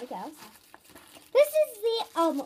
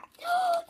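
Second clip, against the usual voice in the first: high sharp gasp of delight; excited gasp; 3 dB louder than usual. Real, not acted. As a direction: gasp like you spotted treasure. Sees favourite design.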